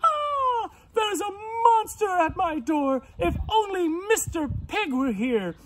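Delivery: high squeals easing into a pleading wail; acted frightened scream. Missus Pig sees a monster at her door, theatrically scared. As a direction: shriek and fret like a storybook pig. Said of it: "she was so scared, she screamed".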